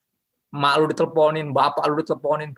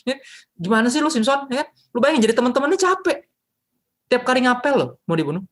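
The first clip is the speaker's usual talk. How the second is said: exasperated; 10 semitones higher than usual. Real, not acted. Preacher fed up with Samson's foolishness, exasperated. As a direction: throw up your hands in disbelief.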